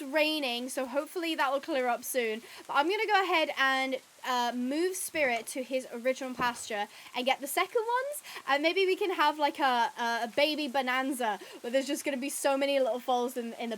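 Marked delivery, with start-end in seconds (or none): none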